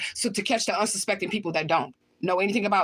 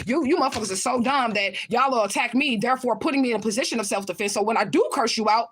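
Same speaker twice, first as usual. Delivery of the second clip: ordinary talk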